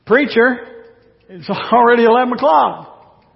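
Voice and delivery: hungry disciples begging to leave; impatient complaining. Acted. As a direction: nag the preacher to wrap it up.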